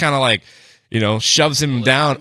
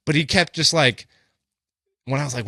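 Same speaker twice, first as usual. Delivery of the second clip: ordinary talk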